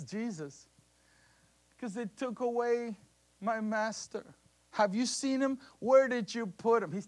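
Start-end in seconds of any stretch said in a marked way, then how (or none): 1.8-6.9 mock frantic plea. Mary pleading for her master.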